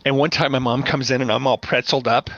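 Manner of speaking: normal talking voice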